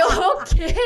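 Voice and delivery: loud, high mock-horrified outburst; incredulous. Her reaction to climbing a rope.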